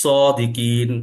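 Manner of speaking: সদিকিন opened loud and heavy; thick Sad. Shown as a demonstration. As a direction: hit a thick, long first syllable in সদিকিন. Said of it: "উচ্চারণটা মোটা হয়ে গেল".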